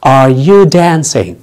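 each word drawn out slowly; slow teaching model of Are you dancing; slow demo. Shown as a demonstration. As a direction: say it slowly so students can follow. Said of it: "هل أنت بترقص؟".

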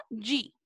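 G sounded as Portuguese "dji"; lone DE as "dji". Shown as a demonstration. Teacher restating the rule for "de", pronounced "dji". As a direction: say G like English "jee". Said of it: "pronunciarla siempre como".

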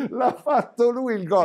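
high laughing voice. Real, not acted. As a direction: laugh through the words. Laughing after mock goal call.

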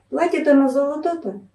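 ordinary delivery, nothing marked

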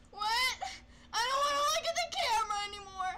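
high, loud, fast, tearful-laughing wail; laugh-cry in panic; panicked crying. Real, not acted. Gamer refusing to look at the camera, high panicked laugh-cry.

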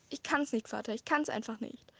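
ordinary delivery, nothing marked

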